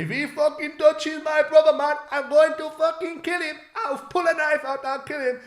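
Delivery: very high, loud hysterical wail; hammed-up tearful shrieking; imitated crying scream. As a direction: mimic a frantic lad screaming threats. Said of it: "crying and screaming and going".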